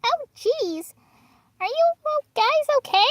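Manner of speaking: very high, squeaky little cartoon voice; tiny high-pitched character voice; Marshmallow impression. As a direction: pitch it way up, small and careful. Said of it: "I could do my marshmallow impression".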